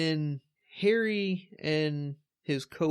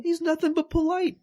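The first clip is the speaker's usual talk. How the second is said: much higher, sing-song mock defence; 3 dB louder than usual. Host jokingly defends Snape to co-host, high mock-sweet voice. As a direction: pitch it way up in playful protest.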